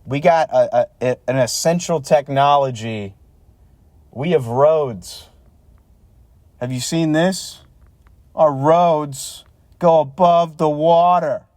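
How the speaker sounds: sarcastic show-off voice; hammed-up local pride; mock boastful Pittsburgher. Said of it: "That's when they say".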